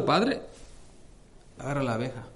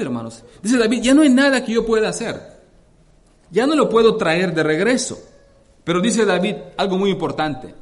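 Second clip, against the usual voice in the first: loud, raised preaching voice, driving each phrase; preaching at full force; 5 semitones higher, 6 dB louder than usual. Acted. Preacher to congregation telling of David, at full force. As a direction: proclaim the scripture to the congregation.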